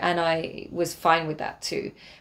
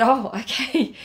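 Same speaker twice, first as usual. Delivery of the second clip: laughing relief; 6 semitones higher, 3 dB louder, slower than usual. Retold or imitated. Learns he just wants milk.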